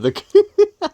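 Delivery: bursts into a high, delighted laugh; can't hold back the laugh. Real, not acted. Laughing to viewers at his fixed card.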